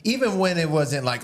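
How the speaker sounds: usual speaking voice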